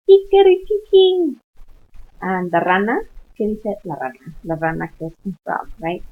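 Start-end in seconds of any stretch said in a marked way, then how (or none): 0.1-1.4 very high, loud "kikeriki-king" crow; Spanish rooster crow. Shown as a demonstration. Teacher shows the Spanish rooster call, crowing high and loud. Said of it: "in Spanish it's".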